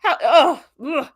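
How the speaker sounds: high, loud laugh of disbelief; real laughing groan; exasperated laugh. Real, not acted. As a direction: laugh out the frustration. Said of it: "what another punchable face".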